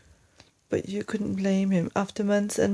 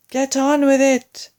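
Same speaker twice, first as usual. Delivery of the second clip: urgent shout; 6 semitones higher, 6 dB louder than usual. Acted. Urgent order to hurry.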